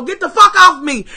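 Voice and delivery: high, loud yell to get off; panicked yell. Retold or imitated. Running scared, yelling at Diego to let go, loud. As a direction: shove him off at the top of your voice. Panicked runner shoves off friend.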